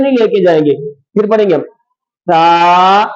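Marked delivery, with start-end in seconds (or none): 2.3-3.0 تا with air passed gently over a soft tongue. Shown as a demonstration. Teacher shows students a soft تا, tongue between the teeth. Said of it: "یہ دانتوں کے درمیان میں آ جائے گی".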